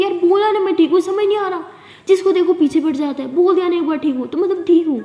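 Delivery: high, loud, fed-up snap; girl loses patience on the phone, snapping at her friend; irritated snapping. Acted. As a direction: bite back at the worried friend. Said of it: "ये ऐसे क्यों बात कर रहे हो इतने उखड़ के".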